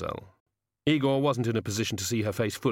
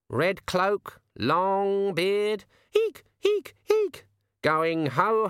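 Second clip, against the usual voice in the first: slow, much higher croaking bird voice; talking raven; 9 semitones higher, much slower than usual. A narrator's reading. The raven telling Susan her grandfather's gone mad, high slow bird voice. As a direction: speak like a sardonic raven.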